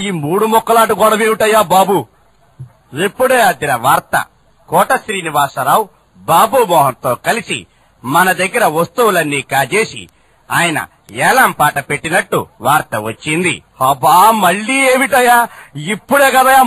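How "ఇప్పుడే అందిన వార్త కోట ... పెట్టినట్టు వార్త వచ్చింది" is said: newsreader voice. Acted. Reporter bringing the boss fresh news. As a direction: announce it like breaking news.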